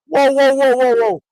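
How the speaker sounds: loud, high string of whoas; alarmed halting call. Retold or imitated. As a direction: call a sudden stop, imitating the trainer. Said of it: "as Pops goes like this".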